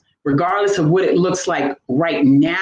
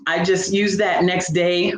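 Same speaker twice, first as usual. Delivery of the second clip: ordinary talk, nothing marked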